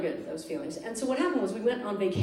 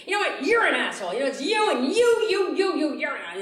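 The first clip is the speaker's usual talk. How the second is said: high, pointed mock accusation; hammed-up finger-pointing rant; mock blaming; 6 semitones higher, 4 dB louder than usual. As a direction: jab at them with each you.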